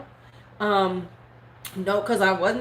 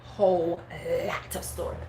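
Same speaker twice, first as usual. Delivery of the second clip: whispered echo; 4 semitones lower, 6 dB quieter than usual. Real, not acted. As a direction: repeat it under the breath. Echo repeated hushed.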